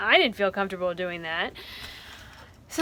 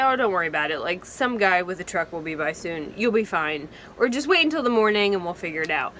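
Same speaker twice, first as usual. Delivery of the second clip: reassuring friend; 5 semitones lower, faster than usual. Retold or imitated. Reassuring a stuck girlfriend.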